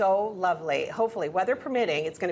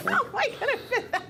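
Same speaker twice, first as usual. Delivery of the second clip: laughing. Real, not acted. Huge sandwich, host laughing.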